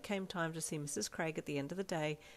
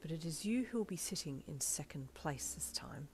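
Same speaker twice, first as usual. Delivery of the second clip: soft unvoiced whisper; 6 dB quieter than usual. A narrator's reading. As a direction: whisper it back. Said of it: "he whispered back".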